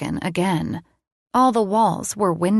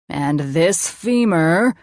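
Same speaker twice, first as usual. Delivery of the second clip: very slow, playful menace; funny villain voice; 4 dB louder, slower than usual. A narrator's reading. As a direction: drag out the words like a mad scientist. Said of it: "in a funny voice".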